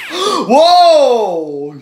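long, high whoa; startled reaction. Real, not acted. Startled by the snake on screen.

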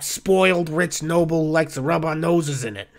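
put-on weary bartender with a northeastern US accent; sigh, then dish it out in northeastern US barkeep talk. Bartender tells Oz who met Floon, northeastern US accent. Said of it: "have the exact same accent".

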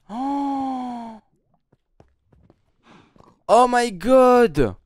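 panicked shrieks after a jump scare; high, loud terrified yelling; scared horror game streamer. Real, not acted. Still reeling from the scare.

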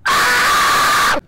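high, harsh, long scream; terrified scream. Real, not acted. Player attacked by the monster, terrified scream. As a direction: scream out in fright.

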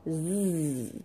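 Z as a long voiced "zzz"; drawn-out Z sound. Shown as a demonstration. Teacher gives pupils the second column's sound, long buzzed Z. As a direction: sustain the buzz of Z alone. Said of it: "quand vous entendez".

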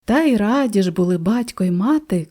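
ordinary delivery

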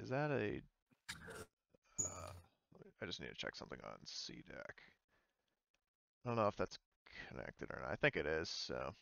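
ordinary talk

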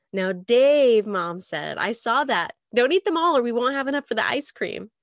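loud teasing reprimand; playful scolding. Acted. Mom playfully scolds Dave for eating strawberries, loud and teasing. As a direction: tease him for sneaking strawberries.

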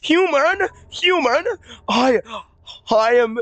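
high-pitched, hammed-up game hero; exaggerated high skeleton voice; high cartoon character. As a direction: call out in a high, silly character voice. Acts Papyrus character voice.

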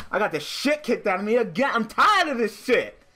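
high, loud raging complaint; furious high-pitched rant; frustrated rant. Real, not acted. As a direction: vent after losing again. Raging rant after loss.

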